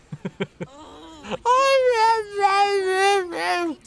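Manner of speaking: a high strained husky yelp; mock husky howl; husky impression. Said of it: "That's my husky impression".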